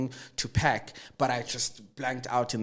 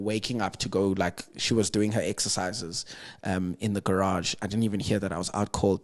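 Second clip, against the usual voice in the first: ordinary talk, nothing marked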